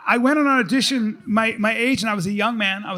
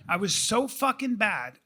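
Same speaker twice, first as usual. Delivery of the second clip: usual speaking voice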